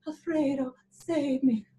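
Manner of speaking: lightly hammed-up diva plea; a hint of a swooning opera heroine; fainting opera diva. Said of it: "to be the third act diva".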